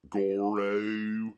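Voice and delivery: mock country drawl on Goro; rural accent. Mimicking rural folk naming Goro, country accent. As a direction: put on a rural twang for Goro. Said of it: "in rural parts".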